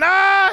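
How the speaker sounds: high, loud scream of terror; piercing mock-terrified "ah!"; imitated scream. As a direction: scream like someone hanging on for life. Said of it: "And he's screaming".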